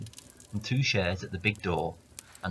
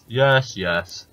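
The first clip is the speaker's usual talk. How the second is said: giant voice; 7 dB louder than usual. Acted. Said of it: "says the giant".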